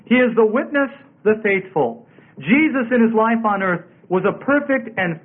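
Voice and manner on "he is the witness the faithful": WITNESS set apart and raised, then THE FAITHFUL; emphatic. Shown as a demonstration. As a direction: land "witness" and "the faithful" as two emphatic phrases. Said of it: "emphatic in the original".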